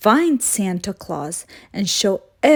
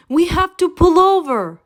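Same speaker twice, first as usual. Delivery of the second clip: higher, louder call over the wind; hollering; 9 semitones higher, 3 dB louder than usual. A narrator's reading. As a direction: shout over whipping winds. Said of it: "hollered Yellow Truck".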